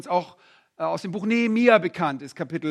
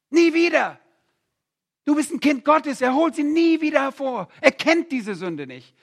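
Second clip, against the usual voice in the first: higher and louder, preaching at full force; impassioned pulpit proclamation; fervent preaching; 6 semitones higher, 7 dB louder than usual. Acted. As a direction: drive home that it never returns.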